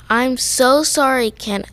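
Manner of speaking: usual speaking voice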